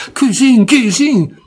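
put-on high, loud, irked Turin-accent cry; annoyed in Turin dialect. Annoyed drunk cousin insisting, Turin accent. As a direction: insist irritably in broad Piedmontese.

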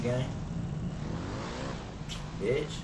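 normal talking voice

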